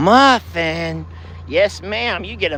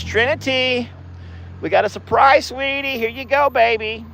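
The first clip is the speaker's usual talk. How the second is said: cooing to cows; 10 semitones higher than usual. Acted. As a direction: coo brightly to greet each cow by name.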